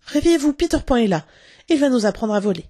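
loud, high, urgent rousing; excited wake-up call. A narrator's reading. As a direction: shake them awake with thrilling news. Girl excitedly wakes her brothers.

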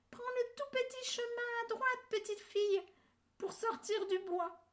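very high little bear voice calling out; tiny bear calling. A narrator's reading. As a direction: call kindly as the littlest bear. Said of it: "de sa toute petite voix". (toute petite voix d'ourson qui crie)

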